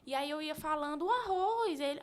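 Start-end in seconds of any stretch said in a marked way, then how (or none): 1.1-1.8 put-on higher, louder sing-out to a toddler; naming food for baby. Mother naming food as she feeds her son, higher and louder. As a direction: present the food word brightly and clearly.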